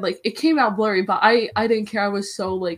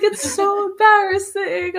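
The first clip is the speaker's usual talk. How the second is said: mock embarrassed squeal; 12 semitones higher, 6 dB louder, slower than usual. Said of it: "I was like".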